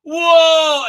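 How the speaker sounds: high, loud drawn-out whoa; mock slow-motion dodge. Doctor ducking the sword, high loud slow-motion whoa. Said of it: "then in slow motion, she goes".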